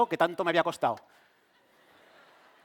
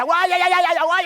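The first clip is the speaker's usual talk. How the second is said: imitated labour screams; 11 semitones higher, 9 dB louder than usual. Wife screaming in labour, retold.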